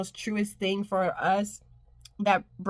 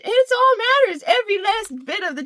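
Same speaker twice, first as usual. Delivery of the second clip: excited; 10 semitones higher, 6 dB louder than usual. Real, not acted. Sudden loud emphatic line.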